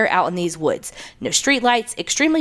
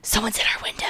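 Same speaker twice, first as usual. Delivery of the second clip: urgent hushed whisper; frantic whisper; 7 dB quieter than usual. A narrator's reading. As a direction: whisper it, scared and rushed. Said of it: "my sister's frantic whisper at my door".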